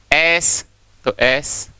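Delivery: एस तो एस, very slow and spaced; sounding out slowly. Shown as a demonstration. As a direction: break it down sound by sound. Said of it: "slow sounding out". (धीरे-धीरे ध्वनि अलग करके)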